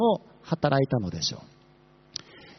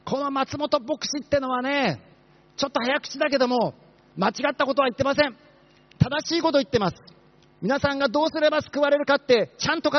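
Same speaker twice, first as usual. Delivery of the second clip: possessed woman shouting a welcome; 7 semitones higher than usual. Pretend. Possessed woman at the entrance hurrying worshippers inside, shouting. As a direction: bellow the greeting like a woman gripped by a spirit. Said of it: "悪霊に疲れた人が立って".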